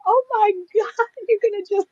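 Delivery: very high, gushing exclamation; imitated excitement. Psychic reader thrilled about client's future, imitated excitement. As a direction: squeal it as the thrilled reader would. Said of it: "she got so excited".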